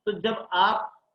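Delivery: usual speaking voice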